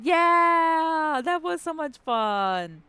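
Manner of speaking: loud high whoop; excited cheer. Real, not acted. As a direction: cheer out with joy. Cheering girls' night out.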